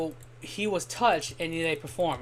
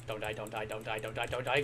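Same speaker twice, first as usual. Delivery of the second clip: muttered pleading; 8 semitones lower, 8 dB quieter than usual. Real, not acted. Pleading with his game character.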